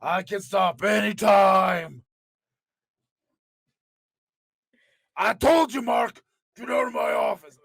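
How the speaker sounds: Vince impression. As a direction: yell at him to get out. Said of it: "Fucking Vince is hard to do".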